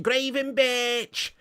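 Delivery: high, stretched-out Scouse pronunciation; Scouse accent. Acted. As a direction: drag the name out in mock Scouse. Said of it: "Proper Scouse".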